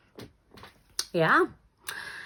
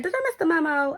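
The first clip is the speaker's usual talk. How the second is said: baffled question; 11 semitones higher, faster than usual. Retold or imitated. Repeats it to the girl.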